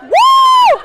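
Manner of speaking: high loud whoop; host whooping for the team; shout. Real, not acted. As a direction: let out a big woo. Host cheers.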